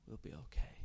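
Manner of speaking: soft whispered farewell; faint whisper. Acted. Children whispering goodbye as the mother sinks away. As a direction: barely breathe the words.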